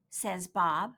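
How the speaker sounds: usual speaking voice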